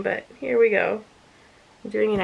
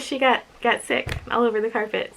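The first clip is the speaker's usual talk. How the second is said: ordinary talk, nothing marked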